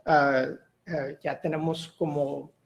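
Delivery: ordinary delivery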